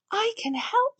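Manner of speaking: gasp, then high I can help; eager offer. A narrator's reading. Peppa offering to name the baby, eager and high. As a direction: jump in eagerly.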